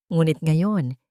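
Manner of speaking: ordinary delivery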